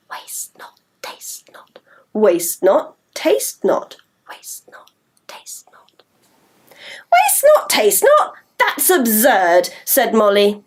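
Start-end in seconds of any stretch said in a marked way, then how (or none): 0.1-1.8 whispered, almost silent; voiceless whispered chant. Shown as a demonstration. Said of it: "Shall we try saying that version?". 4.3-6.0 whispered, almost silent; voiceless whispered chant. Shown as a demonstration. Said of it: "Shall we try saying that version?". 7.1-9.7 scoffing. Acted. Molly scoffs at rhyme.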